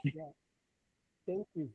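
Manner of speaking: very faint voice; barely audible. Real, not acted. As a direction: far from the mic, hard to hear. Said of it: "Your voice is very faint".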